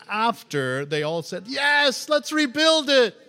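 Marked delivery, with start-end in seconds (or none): 1.5-3.2 high, loud rallying shout; enthusiastic cry. Acted. All the people agreeing to rebuild, excited shout. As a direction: cheer the plan on at full voice.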